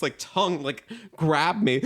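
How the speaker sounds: usual speaking voice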